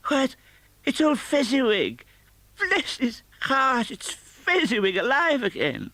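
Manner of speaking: put-on high, thrilled old man's cry; overjoyed to see him again; great excitement. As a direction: Scrooge spotting his old master, crying out in joy. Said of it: "Scrooge cried in great excitement".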